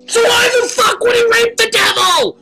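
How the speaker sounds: high, loud screaming outburst; furious rant. Acted. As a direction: explode at the asker.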